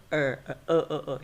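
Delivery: er repeated as an isolated neutral vowel; schwa sound. Shown as a demonstration. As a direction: drill the short neutral er. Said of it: "Er is schwa sounds".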